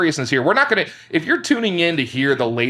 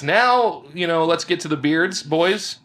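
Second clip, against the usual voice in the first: ordinary talk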